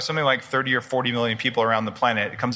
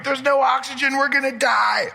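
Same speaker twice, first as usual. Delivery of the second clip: panicked cells; 12 semitones higher than usual. Retold or imitated. Cells freaking out with no oxygen, high mock-panic shout. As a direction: play the terrified cells squealing for air. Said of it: "your cells are gonna be freaking out going".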